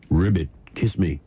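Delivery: lightly voiced low frog; talking frog. Frog answers the grateful man, lightly voiced and low. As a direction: sink well down for a slight frog voice. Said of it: "The frog replies".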